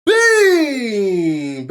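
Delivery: loud, high YouTube greeting; hype intro. Real, not acted. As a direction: shout the channel intro.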